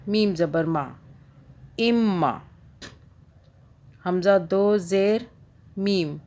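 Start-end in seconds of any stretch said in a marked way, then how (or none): 1.8-2.4 इम्मा with the m held double; doubled meem. Shown as a demonstration. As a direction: hold the m in इम्मा before releasing ma. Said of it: "दो मरतबा आवाज़ें निकलेंगी सबकी".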